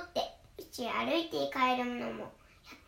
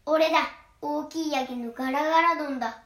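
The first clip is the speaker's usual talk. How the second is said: booming big goat; 2 semitones higher, 7 dB louder than usual. Acted. Big goat answers the troll with a loud boom. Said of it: "ひどくしゃがれたガラガラ声でした".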